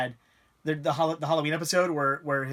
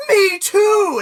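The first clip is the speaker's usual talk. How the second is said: high, loud, delighted "Me too!"; mock yell of eager agreement; gleeful mock shout; 11 dB louder than usual. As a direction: blurt it out like an excited kid. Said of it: "Lithgow goes".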